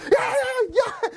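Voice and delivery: very high mock voice, laughing a little; high squeaky yeahs. Host imitating the fleeing mentor in a high squeaky voice. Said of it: "He's just like".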